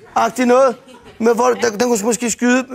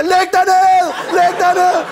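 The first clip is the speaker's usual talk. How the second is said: police shout; 7 semitones higher, slower than usual. Retold or imitated. Police yelling at the driver on the ground, mock shout. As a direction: bark it like an armed officer. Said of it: "trækker pistolen og siger".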